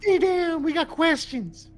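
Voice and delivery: high-pitched order; commanding. Acted. Player holding the gnome for questioning, high insistent command.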